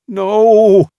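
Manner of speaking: high, loud anguished cry of no; scream. A narrator's reading. Luke screaming as his friend is mauled. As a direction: scream it out in horror as the bear strikes. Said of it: "Luke screamed".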